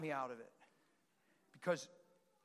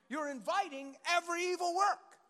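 usual speaking voice

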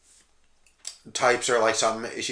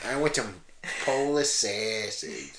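put-on slow, drawn-out Chicago accent on the food; mock Chicago accent; 7 dB quieter, slower than usual. Co-host demonstrates Chicago, drawn-out mock accent. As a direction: stretch the broad Chicago vowels. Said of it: "The Chicago accent".

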